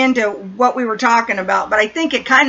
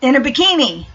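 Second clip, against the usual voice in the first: usual speaking voice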